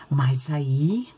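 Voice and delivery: suspenseful whisper. Acted. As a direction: hush it to build suspense. Suspense before the serpent. (sussurro de suspense)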